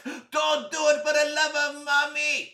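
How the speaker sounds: high, loud, desperate cry; panicked plea. Acted. Friend begs boy not to press button, panicked shout. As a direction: shout it as a frantic last plea.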